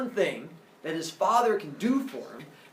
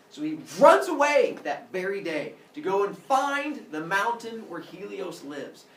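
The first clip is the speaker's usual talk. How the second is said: ordinary delivery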